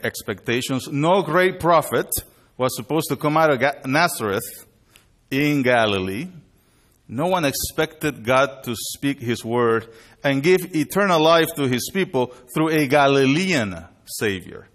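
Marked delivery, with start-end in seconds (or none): none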